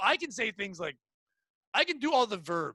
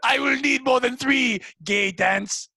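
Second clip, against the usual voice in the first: mock French shout; 3 semitones higher, 7 dB louder than usual. Mock French character complaint.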